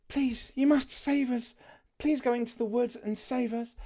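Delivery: high, pleading princess voice. Shown as a demonstration. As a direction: beg sweetly like a fairy-tale princess. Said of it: "the princess".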